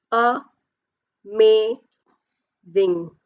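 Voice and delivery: "A, ma, zing" with a gap after each beat; syllable by syllable. Shown as a demonstration. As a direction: sound out A, ma, zing separately. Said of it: "Three times your chin touches".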